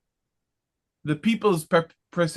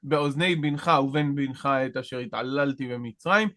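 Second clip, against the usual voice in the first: quick scripture reading; 3 semitones lower, much faster than usual. Acted. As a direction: rattle off the Hebrew verse fast. Reads Hebrew verse quickly.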